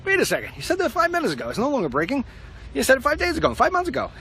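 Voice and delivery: high, incredulous complaint; exasperated. Acted. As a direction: squeak it out in disbelief. Mock exasperated rant at news.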